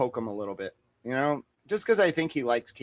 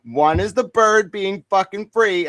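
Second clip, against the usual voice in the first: laughing insistence; 6 semitones higher, 7 dB louder, slower than usual. Real, not acted.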